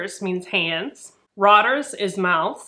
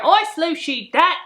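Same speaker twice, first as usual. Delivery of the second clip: high-pitched Cockney call; amateur Cockney accent, pitched up; mock Cockney; 8 semitones higher, 3 dB louder than usual. Shown as a demonstration. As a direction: throw out a quick put-on Cockney line. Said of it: "doing the accent".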